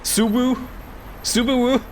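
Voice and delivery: "Subaru" said high and far off, a silly guess at "Suave"; playfully mangling "Subu? Subaru?" into a car name; mock guess. Shown as a demonstration. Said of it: "I'm just gonna pronounce it Subaru".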